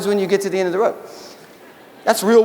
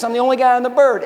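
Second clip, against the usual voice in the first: ordinary delivery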